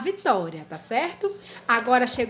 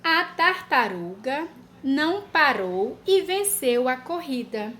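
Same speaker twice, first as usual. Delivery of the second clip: dictation pace. Shown as a demonstration. Teacher dictating a sentence to children. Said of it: "E última frase".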